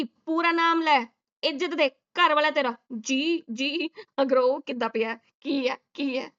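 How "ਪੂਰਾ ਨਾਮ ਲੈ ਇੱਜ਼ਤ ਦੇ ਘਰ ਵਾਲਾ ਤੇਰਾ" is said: mock stern lecture. Real, not acted. Teasing the wife to respect husband.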